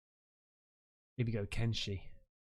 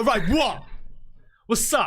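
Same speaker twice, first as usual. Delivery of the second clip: tough challenge; 6 semitones higher than usual. Pretend. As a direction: square up to the ghost, half-joking. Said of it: "just be in bed and just go".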